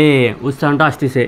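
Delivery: ordinary talk, nothing marked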